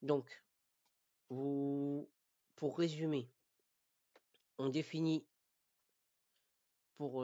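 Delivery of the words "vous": ordinary talk, nothing marked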